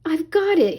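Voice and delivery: lightly voiced silly seagull; Scuttle declaring. A narrator's reading. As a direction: announce it like a proud know-it-all bird. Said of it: "he declared".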